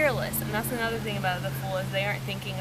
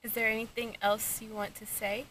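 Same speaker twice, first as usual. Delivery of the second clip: talking to a ghost; 3 semitones higher than usual. Real, not acted. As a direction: ask the ghost gently. EVP session questions.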